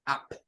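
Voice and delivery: "Up" with a short open uh vowel; short uh sound of "Up"; demo of up. Shown as a demonstration. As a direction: say "Up" as uhp. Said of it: "Como en la palabra up".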